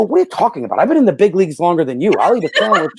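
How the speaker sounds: retold brash comeback; cocky retort. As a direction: fire back at a grown-up, mock-indignant. Retold cocky kid's retort to umpire.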